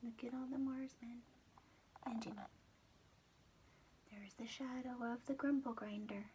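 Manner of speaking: hushed whisper to the listener; whispered aside. Acted. Storyteller whispering to the child about the picture. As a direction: lean in and whisper, secretive.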